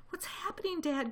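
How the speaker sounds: high, small voice crying out; small foxes' cry. A narrator's reading. Small foxes to their dad, crying out. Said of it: "cried the small foxes".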